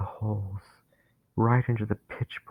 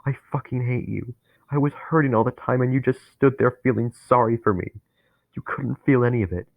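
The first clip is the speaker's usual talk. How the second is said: louder, higher, rabid hatred; growled with vitriol; 3 semitones higher, 3 dB louder than usual. Acted. Dennis snarling his hatred at Harry. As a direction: spit every word with venom. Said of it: "word growled and soaked with vitriol".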